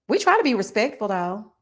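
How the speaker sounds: normal talking voice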